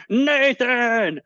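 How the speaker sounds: squeaky high put-on greeting voice; weird voice. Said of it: "I did the weird voice".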